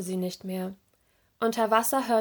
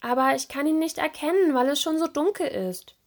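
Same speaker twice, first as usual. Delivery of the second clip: higher-pitched, plaintive complaint; unhappy; 6 semitones higher than usual. A narrator's reading. Serena explaining why she is lost, unhappy. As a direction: plead the excuse in a high voice. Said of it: "Serena verzog unglücklich das Gesicht".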